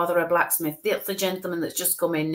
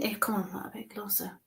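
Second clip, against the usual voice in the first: whisper, coaxing something closer; a breathy whispered come-closer; hushed coaxing; 12 dB quieter than usual. Real, not acted. Medium coaxing the spirit nearer, whispered.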